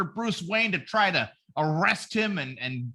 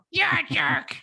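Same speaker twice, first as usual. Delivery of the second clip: mock girlfriend; 12 semitones higher than usual. Imitating offended girlfriend.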